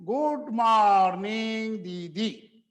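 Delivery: Good morning दीदी stretched long and slow; children's sing-song. Retold or imitated. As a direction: mimic kids chanting "Good morning दीदी". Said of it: "ऐसा लंबा करके बोलते हैं". (बच्चों जैसा खींचकर बोलना)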